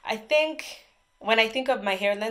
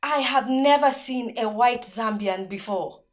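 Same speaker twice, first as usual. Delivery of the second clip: put-on slow, higher-pitched Botswana accent, astonished; imitated Botswana accent; 4 semitones higher, 4 dB louder, slower than usual. Classmate stunned by a Zambian, imitated Botswana accent. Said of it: "including the accent, this is exactly what she said".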